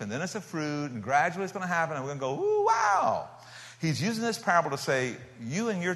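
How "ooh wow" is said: high squeaky mock wonder; playful fake awe; mock amazement. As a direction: gush it like a gawking onlooker. Imitating people watching slowly.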